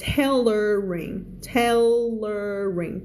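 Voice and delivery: TAILORING drawn out syllable by syllable; slow syllables. Shown as a demonstration. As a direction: stretch TAILORING to show both L and R. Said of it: "ออกเสียงยากนิดนึงนะ".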